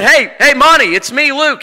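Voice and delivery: high, loud hail to a friend behind; calling out. Retold or imitated. Dreamer hailing his friend on the stairway, calling out. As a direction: call up excitedly to catch his attention.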